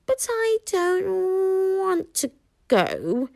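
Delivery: high, slow small dragon voice, matter-of-fact; reasonable little dragon. A narrator's reading. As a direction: point it out calmly as a tiny dragon. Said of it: "Toothless pointed out equally reasonably".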